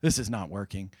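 low-key, dry mockery; understated acted taunt; mocking. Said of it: "He's just mocking them".

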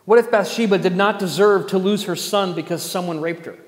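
loud, insistent rhetorical question; impassioned preaching. Real, not acted. Preacher defending Bathsheba to the congregation, impassioned. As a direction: press the question hard on the congregation.

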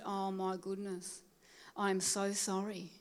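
soft, slow, sheepish apology; apologetic. Retold or imitated. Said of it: "I said".

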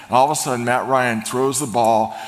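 ordinary talk

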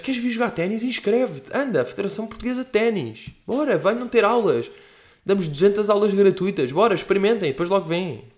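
mock promo announcer, lightly voiced; ad pitch. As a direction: sell it like a tennis federation ad. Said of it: "anúncios ... mupis".

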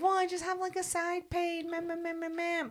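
high, soft whimpering whine; mock the crybaby in a squeaky whimper; whiny mockery. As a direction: friend mocking the boy's side pain, whiny squeak. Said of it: "you're like".

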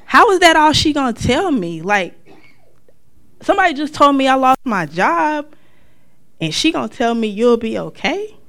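venting hurt disbelief at mom; loud exasperated complaint; indignant outrage. Retold or imitated. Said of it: "I was actually mad at her".

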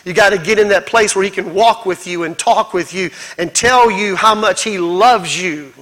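usual speaking voice

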